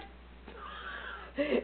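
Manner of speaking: silent wheezing laugh, up high; high breathless laugh. Real, not acted. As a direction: laugh without voice. Laughing at the parrot story.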